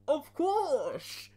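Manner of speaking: high loud mock villain. Bane scene dialogue.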